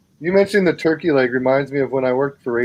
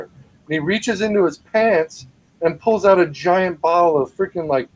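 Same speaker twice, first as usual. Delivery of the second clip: usual speaking voice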